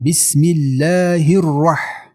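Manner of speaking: slow chant into a thick 'الرح'; heavy ra. Shown as a demonstration. Teacher models ra with fatha, slow and heavy. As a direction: recite it slowly with a full doubled r.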